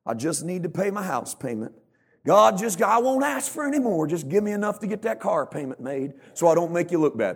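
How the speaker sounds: high, pleading prayer, hammed up; selfish prayer. Preacher mimicking a selfish prayer to God, pleading. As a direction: beg God with a loud, high opening. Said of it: "A selfish prayer is".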